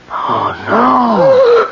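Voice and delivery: high, loud wail of horror; horrified cry. Acted. Handyman finding the body, crying out in horror. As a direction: recoil at the sight.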